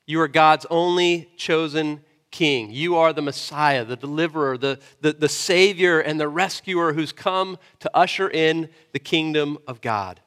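usual speaking voice

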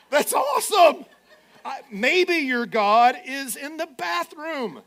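high, loud laughing burst; laughing delight. Real, not acted. Pastor enjoying the joke, laughing loudly. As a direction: crack up at the verse.

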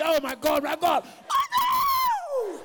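high loud anguished cry; mock distress. Churchgoer hearing the sermon, crying out high and loud in mock distress. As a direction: squirm and wail it high. Sinner squirming under preaching.

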